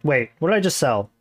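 ordinary delivery

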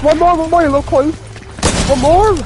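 high, loud squad callouts; excited shouting. Real, not acted. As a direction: shout with the win in reach. Callouts at end of firefight.

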